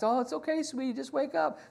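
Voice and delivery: gentle soothing. Retold or imitated. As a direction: coax her gently out of a nightmare. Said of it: "I just gently reach over there".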